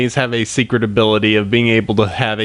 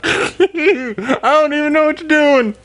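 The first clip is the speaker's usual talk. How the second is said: mock baffled. Americans reacting to bad accent.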